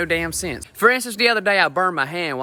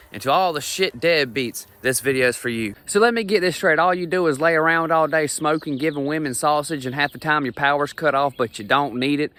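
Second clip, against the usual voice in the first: ordinary talk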